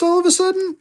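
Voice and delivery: wavering up high, as if by accident; suddenly high-pitched. Real, not acted. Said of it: "my voice gets all high-pitched".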